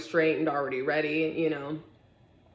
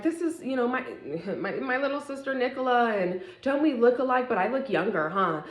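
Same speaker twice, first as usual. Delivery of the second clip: mock sweet sister; 7 semitones higher than usual. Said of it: "my sister's like".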